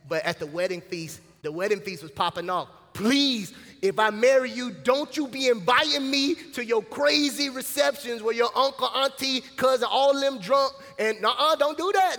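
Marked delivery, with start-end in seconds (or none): 2.9-12.2 loud, high scolding bride; a mock bride laying down the law to her groom; fed-up bride. A bride warning her groom off his rowdy family, loud and high.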